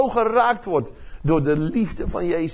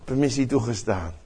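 lower, softer calm verdict; God granting permission; 6 semitones lower, 4 dB quieter than usual. Retold or imitated. God answers Jesus and grants permission, lower and softer. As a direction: settle it with quiet authority. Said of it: "En God zegt".

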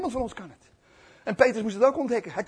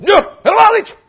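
high, loud quick denial; hammed-up raised-voice refusal; panicky mock denial; 14 semitones higher, 18 dB louder than usual. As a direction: blurt out a protest. Peter denies, acted.

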